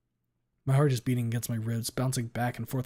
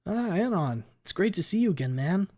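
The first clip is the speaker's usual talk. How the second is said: a touch of a cheery friend; 7 semitones higher than usual. A narrator's reading. Dead friend greets him cheerfully.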